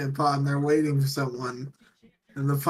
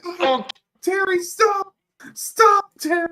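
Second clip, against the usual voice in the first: high, louder hammed-up plea; mock pleading yell; 4 dB louder, slower than usual. Astronauts begging the pilot to stop pouring, high mock yell. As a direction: yelp at him to stop, pitched way up.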